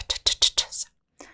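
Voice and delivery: "t" barely released, a whispered puff; soft unvoiced t. Shown as a demonstration. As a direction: hold back the "t" release. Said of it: "we don't make that hard t".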